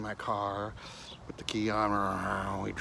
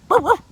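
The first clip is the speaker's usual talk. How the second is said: dog bark; 20 dB louder than usual. Retold or imitated. Dog barking in the story.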